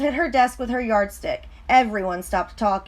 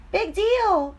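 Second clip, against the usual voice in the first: high, cheeky talking pigtail; 10 semitones higher than usual. Acted. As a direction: tease Paul like a mischievous pigtail. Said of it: "said the pigtail".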